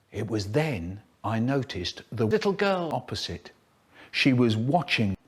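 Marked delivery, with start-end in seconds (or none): none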